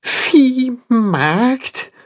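high, shaky, frightened donkey; trembling voice. A narrator's reading. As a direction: let the word quiver with worry. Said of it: "mit zitternder Stimme".

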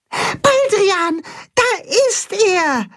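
sehr hoch, freudig aufgeregt; jubelnd. Acted. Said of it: "jubelt Max". (Maulwurf Max jubelt, hoch und aufgeregt)

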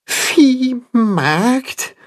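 high, quavering question; frightened donkey's echo; high trembling donkey repeating the word. A narrator's reading. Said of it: "mit zitternder Stimme".

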